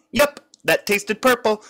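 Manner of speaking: high, chirpy little character voice; cute yordle voice. A narrator's reading. Lulu comments on the taste in a high, chirpy voice. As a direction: say it like a tiny cheerful creature. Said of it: "she said".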